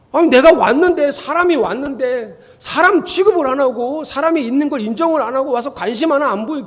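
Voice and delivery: loud, high-pitched grievance; acted indignant complaint. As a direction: argue it as the snubbed newcomer, outraged. Voices a snubbed member's complaint.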